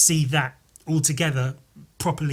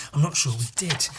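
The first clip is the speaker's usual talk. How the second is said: ordinary talk, nothing marked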